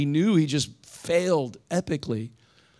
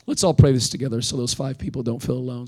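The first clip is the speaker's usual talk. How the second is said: ordinary talk, nothing marked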